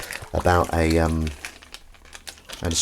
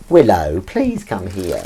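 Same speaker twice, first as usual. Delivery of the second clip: slow, drawn-out plea; pleading with a stubborn pet; pathetic pleading; 4 dB louder, slower than usual. Real, not acted. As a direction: beg the cat pitifully. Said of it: "If I sound pathetic".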